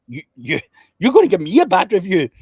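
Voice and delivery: high, loud, scoffing "you're gonna give me a bad review?"; mock the threat with loud disbelief; incredulous disbelief. Retelling his reply to the angry client, high, loud disbelief. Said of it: "I went".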